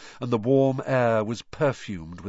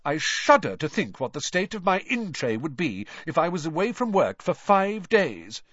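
higher, self-important fussing; sanctimonious; 8 semitones higher, 3 dB louder than usual. A narrator's reading. Percy worrying about his office in-tray, sanctimoniously. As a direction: fret piously about the importance of work. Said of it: "said Percy sanctimoniously".

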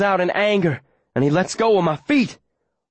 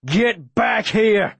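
slow, drawn-out, raised-pitch order; commanding yell; 5 semitones higher, much slower than usual. A narrator's reading. Aaron holding the dog hostage, slow commanding yell. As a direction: yell it as a stretched-out threat. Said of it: "Aaron yells".

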